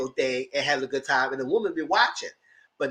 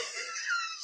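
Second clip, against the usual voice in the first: high, soft giggling laugh; real laughter; 12 dB quieter than usual. Real, not acted. Recapper tickled by own cheering, real high laugh. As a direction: laugh helplessly after squealing.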